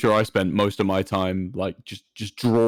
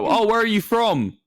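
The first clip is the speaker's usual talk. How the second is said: higher questioning voice; mimicking customer; 10 semitones higher than usual. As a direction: play the curious customer. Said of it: "what are they gonna say".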